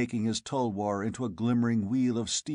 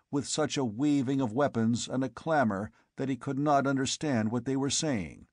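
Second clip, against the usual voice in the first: plain reading voice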